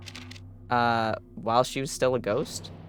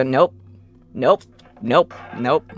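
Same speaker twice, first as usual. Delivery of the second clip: panicked nope; 7 dB louder, slower than usual. Real, not acted. Chased, trying to escape.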